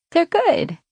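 squeaky high defensive. Acted. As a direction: protest in a high voice. Megan defending the food.

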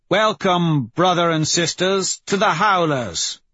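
high, proud proclamation; ceremonial welcome. Acted. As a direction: call it out to the assembled pack. Leader welcomes initiates to the pack.

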